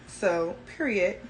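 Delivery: ordinary talk, nothing marked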